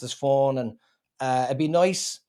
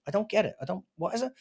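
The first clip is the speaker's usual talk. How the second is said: higher, softer puzzled mumble; confused audience member; 5 semitones higher, 6 dB quieter, much faster than usual. Retold or imitated. Comedian imitating a crowd that missed the joke, baffled. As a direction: sound lost and baffled. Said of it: "other people just went".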